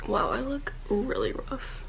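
ordinary talk, nothing marked

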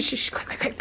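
urgent playful whisper. Pretend. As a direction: hush them, hurry, in a whisper. Urgent playful hush while dressing doll.

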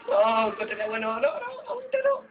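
panicked rising pleas. Real, not acted. Car heading into water.

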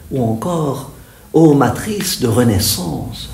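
reverent, declaimed litany with long pauses; devotional praise. Acted. Recites translated praise. (louange déclamée avec ferveur)

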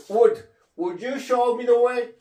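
"would" with a vowel gliding between 우 and 오, said slowly; model would sound. Shown as a demonstration. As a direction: glide the vowel of "would" from 우 toward 오. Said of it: "왔다 갔다 하는 그런 발음입니다". ("would"를 우와 오 사이로 천천히 발음)

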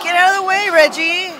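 shouting warning. Real, not acted. Yelling warning to a wrestler.

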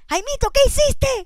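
high, loud, slow shout of shock; mother yelling. Acted. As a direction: scream it at the kid like a horrified mom. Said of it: "La mamá empezó a gritar".

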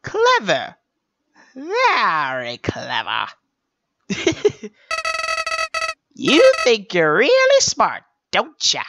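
drawn-out sinister sneer; mock the player slowly; sneering flower.

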